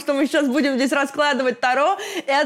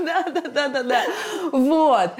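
laughing; 3 semitones higher than usual. Real, not acted. Laughing agreement.

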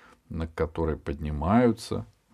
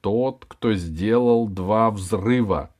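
slow, over-articulated words; slow careful articulation; 2 semitones higher, 5 dB louder, much slower than usual. Acted. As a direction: spell it out carefully and slowly. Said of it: "старательно выговаривая слова".

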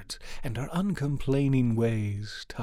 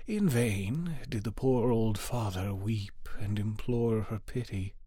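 usual speaking voice

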